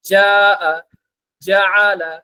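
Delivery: জাআ said firm and loud from the throat; pronunciation drill. Shown as a demonstration. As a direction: stress the জ hard in জাআলা. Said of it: "একটু শক্ত". (জ একটু শক্ত করে উচ্চারণ)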